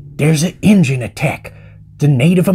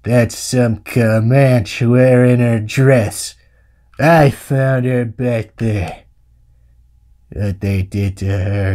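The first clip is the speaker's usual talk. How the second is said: low, very slow, grave drawl; John Wayne; 8 semitones lower, slower than usual. Acted. Uncle correcting the searcher about the girl, slow low drawl. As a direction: drag it out heavily, deep and haunted. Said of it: "doing an impression of John Wayne through my stupid cowboy".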